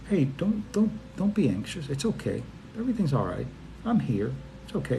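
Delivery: calm someone anxious, hushed and tender; soothing reassurance. Retold or imitated. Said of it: "Otherwise, I would say".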